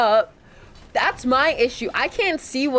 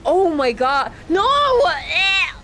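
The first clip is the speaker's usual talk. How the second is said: grossed out; 8 semitones higher, slower than usual. Real, not acted. Reacts to a gross item.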